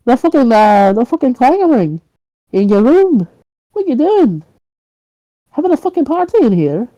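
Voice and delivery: high-pitched, indignant interrogation; irate grilling. Acted. Neighbour grilling him about clanging in his room, irate grilling. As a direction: pile on the annoyed questions, pitch jumping up.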